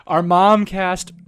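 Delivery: slow, loud goofy emphasis; silly. Pretend. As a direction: drag it out like a joke. Mangling the word on purpose.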